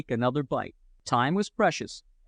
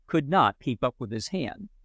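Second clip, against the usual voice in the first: usual speaking voice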